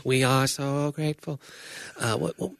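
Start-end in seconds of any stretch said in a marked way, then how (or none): 0.1-1.4 slow, laughing alien voice. Retold or imitated. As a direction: imitate a Galaxy Quest alien, laughing. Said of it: "that was Galaxy Quest".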